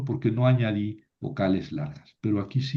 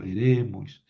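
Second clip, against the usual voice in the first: "Eremois" with its second syllable stressed and held; accent moved forward; 2 semitones lower, slower than usual. Shown as a demonstration. Teacher showing students the moved accent, second-syllable stress on "Eremois". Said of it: "tengo que mover el acento".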